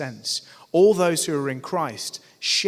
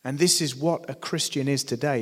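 normal talking voice

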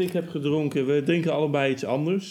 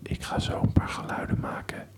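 asmr whisper. Pretend. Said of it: "Welkom bij deze A S M R video". (ASMR-fluisterstem)

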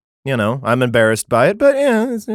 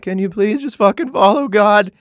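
high, pleading frustrated prophet; exasperated plea; 8 semitones higher, 3 dB louder than usual. Retold or imitated. Jeremiah begging the crowd to follow God, exasperated. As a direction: beg like you're at wit's end.

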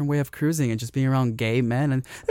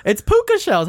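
excited; 4 dB louder than usual. Pretend. Repeats with big pitch jump.

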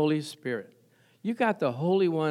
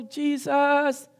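mock yell; 8 semitones higher, slower than usual. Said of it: "You don't have to yell".

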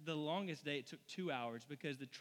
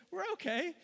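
sheepish mechanic; 12 semitones higher, 5 dB louder than usual. Retold or imitated. Mechanic in the retold commercial.

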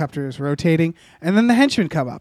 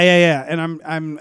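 usual speaking voice

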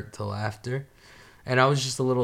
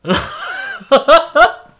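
high, loud burst of laughter; 13 dB louder than usual. Real, not acted. Laughing at his own tactless gym remark, loudly. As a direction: crack up loudly.

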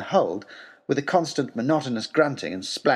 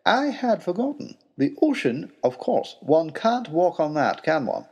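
high, slow, apologetic professor; sheepish; 4 semitones higher, slower than usual. Acted. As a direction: admit it gently, a bit embarrassed. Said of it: "smiled sheepishly".

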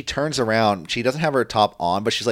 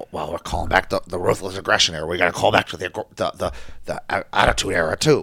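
mock Vince; 4 semitones lower, faster than usual. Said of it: "was Vince just trying to call back".

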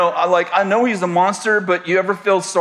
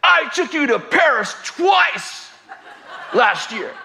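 much higher, slow mock yell; angry yell; 7 semitones higher, slower than usual. Imitating the husband finally snapping back at his wife, a mock yell. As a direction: blurt it out of a stupor, shouting. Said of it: "and he says".

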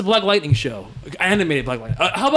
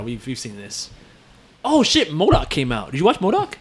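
ordinary talk, nothing marked

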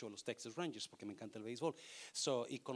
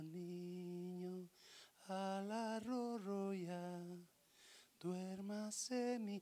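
hushed, much softer crooning with shushing; soft lullaby; 8 dB quieter, much slower than usual. Shown as a demonstration. Parent singing softly so the baby falls asleep. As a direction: sing it gently, slowly, to put a baby to sleep. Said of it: "le cantaba bien suavecito".

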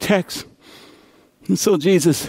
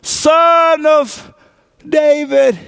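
crying out; 9 semitones higher, much slower than usual. Acted. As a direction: call out across the crowd. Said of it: "someone saying".

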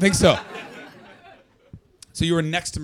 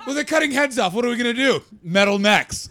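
mock Canadian officials; 7 semitones higher, 6 dB louder than usual. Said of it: "the most Canadian idea".

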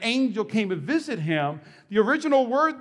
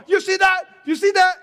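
higher and louder, urgent repeated question; mock alarmed shout; 8 semitones higher, 4 dB louder than usual. Pastor imitating his wife waking in a night terror, mock alarmed shout. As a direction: point at nothing and shout it twice.

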